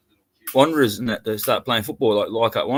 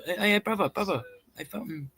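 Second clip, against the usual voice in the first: high, easy-going bouncer-mate voice, higher pitch; butter up the big bouncer, pitched up; mate talk to bouncer; 6 semitones higher, 9 dB quieter than usual. Retold or imitated. Mimics chatting up Islander bouncers.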